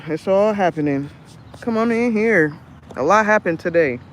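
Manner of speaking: ordinary talk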